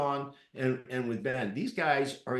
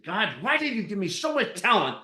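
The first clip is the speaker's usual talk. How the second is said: loud exasperated outburst at God, higher; frustrated rant; 7 semitones higher, 5 dB louder than usual. Retold or imitated. Tennis player venting to God mid-match, frustrated loud outburst. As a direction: vent the frustration hard. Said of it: "And I'm just mad".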